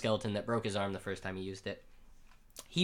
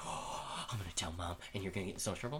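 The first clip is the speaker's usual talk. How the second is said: gasp, then a hushed mock threat to tell; low, hushed playground tattling; scandalized tattling kid; 4 semitones lower, 10 dB quieter, faster than usual. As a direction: imitate a shocked little tattletale, very softly. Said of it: "Travis was like".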